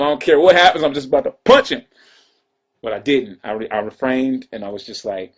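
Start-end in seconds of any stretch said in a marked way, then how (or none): 0.0-1.8 mild mock anger. His own angry thought.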